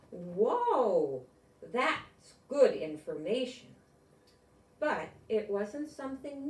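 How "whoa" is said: a sharp gasp then a loud drawn-out whoa; amazed gasp. A narrator's reading. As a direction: gasp in wonder, then let the whoa ring. Amazed at a fact.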